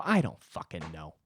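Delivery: low, gruff brush-off; imagined rude reply. Pretend. Brushing off a pestering comic with a low rude snap. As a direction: snap it at the annoying guy, the version never said. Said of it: "I didn't say that".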